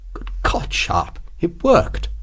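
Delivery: hushed, amazed delight. A narrator's reading. Officer to his companion after the bluff worked, quietly delighted. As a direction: softly marvel at your own success. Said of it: "then gave a delighted chuckle".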